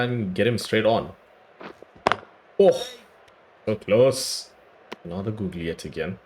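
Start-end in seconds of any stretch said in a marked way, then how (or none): none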